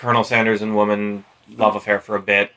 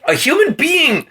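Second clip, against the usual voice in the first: agitated disbelief; 12 semitones higher than usual. Real, not acted. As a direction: dwell on each word in disbelief. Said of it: "agitated again".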